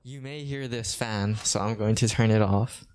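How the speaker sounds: normal talking voice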